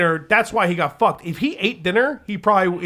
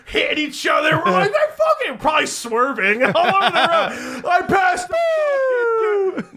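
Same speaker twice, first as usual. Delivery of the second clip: high, squealing mock cheer with laughter; wild celebration; 9 semitones higher than usual. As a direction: re-enact a giddy, out-of-control whoop. Acting out wild celebration while driving.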